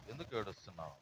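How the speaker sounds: soft, low repeated question; gentle asking. Acted. Jesus asking the weeping woman, gentle asking. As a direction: drop the voice and ask her quietly.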